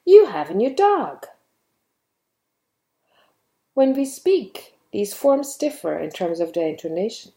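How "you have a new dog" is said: high, loud exclamation with big swings on YOU and DOG; surprised. Shown as a demonstration. Teacher showing how to express your surprise, surprised exclamation. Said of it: "use it to express your surprise".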